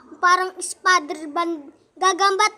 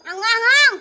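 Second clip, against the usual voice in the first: louder cry at the spicy burn; spicy yelp; 9 dB louder than usual. Real, not acted. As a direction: yell out at the heat. Burger too spicy.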